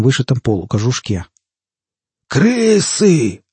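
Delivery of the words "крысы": high, drawn-out cry of alarm; barked alarm. A narrator's reading. Man warning his mates of attackers, barking. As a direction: bellow a warning to the gang. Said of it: "рявкнул Веркта".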